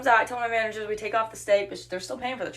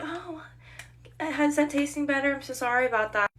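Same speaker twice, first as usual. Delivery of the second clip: put-on gentle, higher-pitched concern; sweet manager; 7 semitones higher than usual. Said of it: "I have a really sweet manager".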